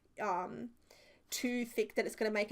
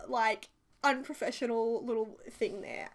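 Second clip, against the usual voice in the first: usual speaking voice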